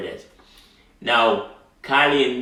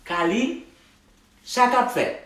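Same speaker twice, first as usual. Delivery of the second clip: acted greeting; 12 semitones higher than usual. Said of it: "Marie asked her".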